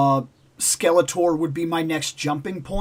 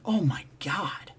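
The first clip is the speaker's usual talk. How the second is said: slow whispered disbelief; stunned whisper; 8 dB quieter, slower than usual. Retold or imitated. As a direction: breathe it out in shock. Hearing six teeth must go.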